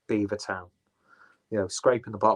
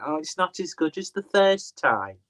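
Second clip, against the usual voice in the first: put-on Wigan accent; 6 semitones higher than usual. Reviewer moaning about a beer rebrew, in a mock Wigan accent. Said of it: "I'm doing a Wigan accent".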